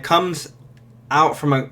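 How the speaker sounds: usual speaking voice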